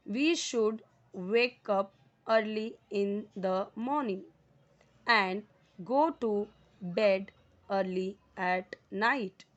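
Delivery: very slow, word by word reading; slow dictation. Shown as a demonstration. Teacher reading to her students, very slow. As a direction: read it out slowly for the class to follow. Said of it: "teacher reads example sentence slowly".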